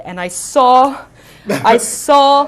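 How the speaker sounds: acted shock, voice rising; horrified recollection. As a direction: build in horror as the roommate can barely say it. Roommate recounts the traumatic sight.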